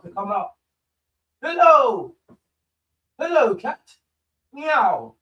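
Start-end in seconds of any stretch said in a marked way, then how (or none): 1.4-2.1 high, loud call across the room; shouting at the cat. Real, not acted. Streamer calling his cat from across the room, loud and high. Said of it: "why am I shouting at the cat?". 3.2-3.8 high calling greeting; shouting at the cat. Real, not acted. Streamer greeting his cat mid-match with a high call. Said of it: "why am I shouting at the cat?". 4.5-5.1 mock meow. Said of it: "why am I shouting at the cat?".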